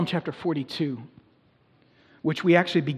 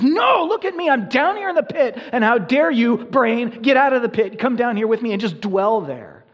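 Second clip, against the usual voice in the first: much higher and louder, pleading demand; pain's voice; 8 semitones higher, 8 dB louder than usual. Retold or imitated. Pain calls to the brain to come down into the pit, high and loud. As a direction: play pain pulling you into the pit. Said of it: "grabs your mental attention and says".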